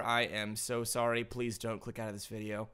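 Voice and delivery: softly begging viewers to stay; quiet plea. Real, not acted. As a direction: murmur it like a sheepish apology.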